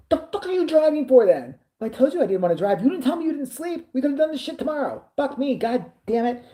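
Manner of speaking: high-pitched retold outburst of frustration; exasperated rant. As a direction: replay losing patience with a friend, pitch way up.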